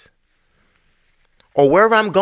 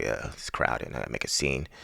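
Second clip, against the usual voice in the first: quiet inner muttering; 14 dB quieter than usual. Retold or imitated. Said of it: "I'm like".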